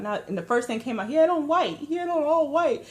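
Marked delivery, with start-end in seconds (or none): 1.1-2.9 nervous blurt. Retold or imitated. Blurting a panicked lie.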